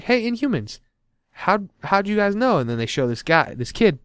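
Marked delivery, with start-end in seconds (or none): 0.0-2.6 high-pitched mock inquiry; questioning quote voice. Iron Man asking the Inhumans, high-pitched puzzled call.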